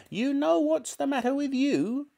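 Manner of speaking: put-on high-pitched, scolding old woman; nasty grandmother. A narrator's reading. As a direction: needle the boy in a high granny voice. Grandmother scolding boy in story.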